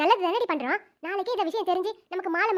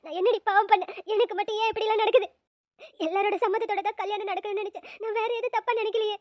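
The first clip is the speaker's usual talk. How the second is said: tearful, frightened lament; sobbing despair. Acted. Bride fearing for her uncle's life, sobbing to a friend. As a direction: pour out dread between sobs. Weeping to friend, sobs heard.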